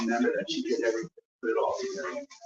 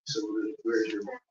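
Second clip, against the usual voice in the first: ordinary talk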